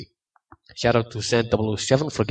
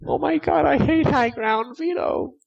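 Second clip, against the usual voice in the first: very high whiny noob yelling; high squeaky shouting. Retold or imitated. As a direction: squeal it like an angry kid gamer. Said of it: "noobs, shouting".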